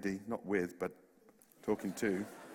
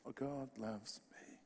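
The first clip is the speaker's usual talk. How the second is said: hushed wonder; 13 dB quieter, slower than usual. Retold or imitated. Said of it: "Went home".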